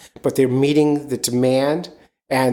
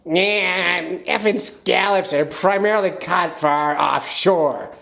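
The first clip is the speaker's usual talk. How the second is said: higher, slow put-on voice like a Maine skipper; Captain Sean impression; 6 semitones higher, slower than usual. Host imitating a fishing captain answering, higher put-on voice. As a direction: do a weird Gilbert Gottfried from Maine. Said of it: "I'll do my impression of Captain Sean".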